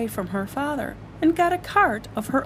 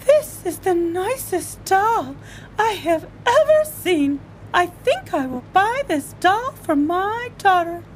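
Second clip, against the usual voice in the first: admiring lady; 8 semitones higher than usual. Acted. Lady admires Taya as a doll, high and delighted. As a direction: coo over a doll.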